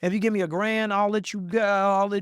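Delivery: high, loud shady name-dropper; sketchy hustler. Retold or imitated. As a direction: play a sleazy fake insider. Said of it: "It wasn't like".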